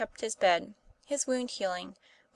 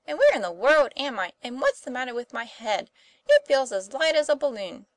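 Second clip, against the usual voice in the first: higher, louder, lively questioning; bewildered boy; 4 semitones higher, 7 dB louder than usual. A narrator's reading. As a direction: fire off puzzled questions on waking. Tom wakes bewildered, acted by narrator.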